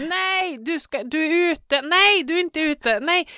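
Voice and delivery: high, loud mock protest; imitated arguing shout. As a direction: act out spectators yelling over a call. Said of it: "och någon skrek".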